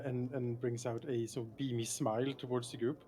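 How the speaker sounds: usual speaking voice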